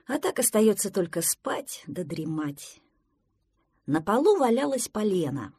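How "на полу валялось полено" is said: ordinary delivery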